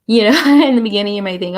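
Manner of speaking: usual speaking voice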